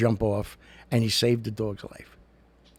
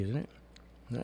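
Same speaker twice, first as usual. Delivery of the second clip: ordinary talk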